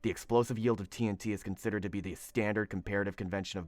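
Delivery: very low, hushed superhero growl; deep, quiet mock-serious voice; Batman. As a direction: read the science text as a brooding dark vigilante. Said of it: "read this in my Batman voice".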